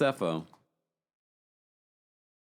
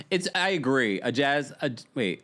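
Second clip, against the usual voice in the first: ordinary talk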